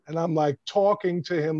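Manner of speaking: normal talking voice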